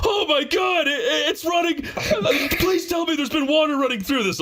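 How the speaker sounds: high alarmed shout; shouting plumber. Retold or imitated. Plumber hearing the rattling tub, high panicked shout. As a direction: yell it up high in comic panic.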